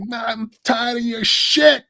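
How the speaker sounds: high, slow tough-guy snarl; Joe Pesci impression. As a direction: drag it out like a fed-up mobster. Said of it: "look, Joe Pesci".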